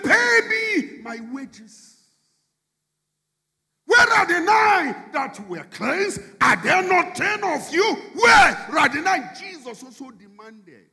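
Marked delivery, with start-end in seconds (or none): 0.0-2.0 high, loud, slow demand. Acted. Preacher voicing a laborer demanding his pay, loud and slow. 3.8-9.3 high, loud shouted demand. Acted. Preacher voicing Jesus asking for the nine lepers, shouted.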